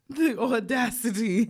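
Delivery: drawn out through real laughter; cracking up. Real, not acted. Podcaster reacting to his text, really cracking up. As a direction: laugh your way slowly through the words. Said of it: "I'm cracking up in real life".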